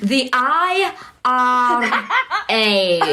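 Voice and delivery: very slow, stretched Mancunian vowels; hammed-up Mancunian warm-up; full Mancunian. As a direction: drag each letter out in broad Mancunian. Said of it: "would you like the full Mancunian".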